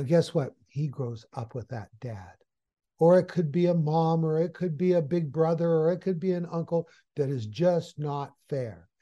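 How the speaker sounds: normal talking voice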